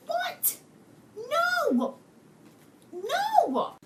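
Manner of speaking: very high, dismayed outcry; shocked disbelief. Acted. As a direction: cry out in high-pitched, drawn-out disbelief. Reacting in disbelief to plot twist.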